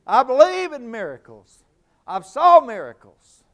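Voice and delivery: loud, high, driving preacher's cry; full-force preaching. Acted. Preacher rousing the congregation, shouting high and loud.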